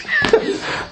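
short burst of high unvoiced laughter; high breathy laugh. Real, not acted. Preacher laughing at the ladies' predicted report, high and breathy. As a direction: laugh at his own punchline.

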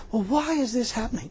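imitating a doubting churchgoer; a quietly mocked whine about troubles; mock puzzled complaint. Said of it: "they say".

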